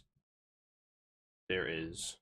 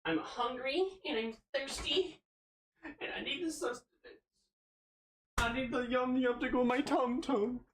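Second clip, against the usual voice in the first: put-on high whiny baby talk; 10 semitones higher than usual. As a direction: whine like a hungry toddler. Baby-talk whine for food.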